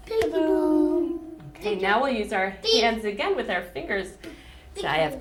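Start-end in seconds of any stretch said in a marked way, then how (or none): 0.1-1.2 high and slow, a playful reveal; spring it on the kids; playful peekaboo. Acted. Peekaboo game with children.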